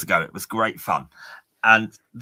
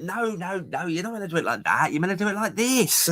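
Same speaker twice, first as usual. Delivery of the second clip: corrective boss; 7 semitones higher than usual. Retold or imitated. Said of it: "Steve overheard him".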